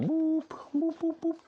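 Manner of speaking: very high squeaky boops; playful boops. Real, not acted. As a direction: boop the nose playfully. Booping pet nose.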